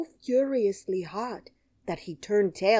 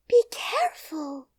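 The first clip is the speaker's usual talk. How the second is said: much higher, louder quick warning; green girl's warning; 10 semitones higher, 6 dB louder than usual. A narrator's reading. The green girl warning Dorothy not to cry, high and urgent. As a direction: call it out kindly but urgent. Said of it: "cried the green girl".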